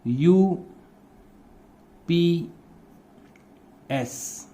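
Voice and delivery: यूपीएस said very slowly, each letter held long; teacher modelling यूपीएस slowly and softly; drawn-out pronunciation. Shown as a demonstration. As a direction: stretch out यूपीएस for the class. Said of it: "इसका जो pronunciation है वो".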